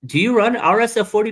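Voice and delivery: incredulous, high question; student's surprise. Retold or imitated. Retelling his student discovering the page, high surprised quote. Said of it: "he goes".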